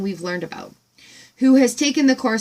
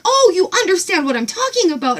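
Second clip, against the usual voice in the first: much higher, bright chirpy reply; farm woman quoted; 9 semitones higher, 4 dB louder than usual. Retold or imitated. Voicing the farm woman answering her, high and chirpy. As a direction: chirp it back delighted. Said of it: "she's like".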